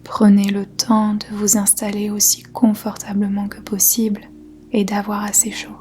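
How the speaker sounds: normal talking voice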